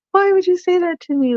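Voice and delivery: retold tearful cry. Mom retells crying at her son's sweet words, mild tearful pitch. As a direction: replay the moment she broke into tears, lightly. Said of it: "I just started bawling".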